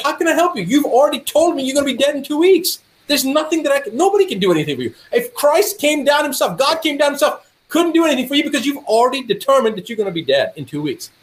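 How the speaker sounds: high-pitched, incredulous retold tirade; exasperated scolding. Doctor shocking self-pitying patient, exasperated scolding. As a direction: scold him emphatically, pitched well up.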